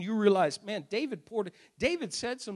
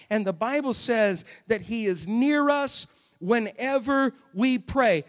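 ordinary talk, nothing marked

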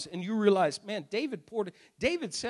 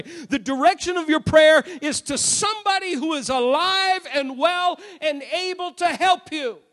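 raised, emphatic sermon delivery; impassioned preaching; 4 semitones higher, 4 dB louder than usual. Acted. Preacher telling congregation prayer reaches a living God, impassioned preaching. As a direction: hammer each contrast home.

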